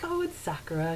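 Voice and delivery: ordinary talk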